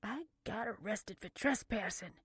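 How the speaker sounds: tight, grudging grunt. A narrator's reading. Blair admitting her arrest to her sister, grunting. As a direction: grunt out the admission. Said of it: "Blair grunted".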